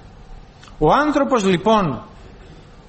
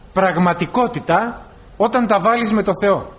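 ordinary talk, nothing marked

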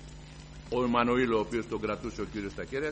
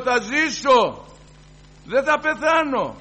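much higher, louder, slow triumphant shout; jubilant cry; 11 semitones higher, 11 dB louder, much slower than usual. Retold or imitated. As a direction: proclaim it with rising joy. Joyful cry of relief. (θριαμβευτική κραυγή, πολύ δυνατά και αργά)

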